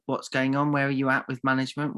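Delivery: usual speaking voice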